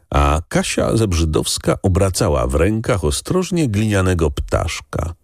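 usual speaking voice

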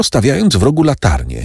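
usual speaking voice

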